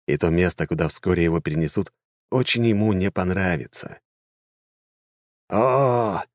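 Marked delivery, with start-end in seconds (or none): 5.5-6.2 put-on high-pitched, chuckling old mage's remark; a pleased, mocking lift in the voice; amused old man. Old mage amused that his victims chose to resist, amused. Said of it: "усмехнулся старик".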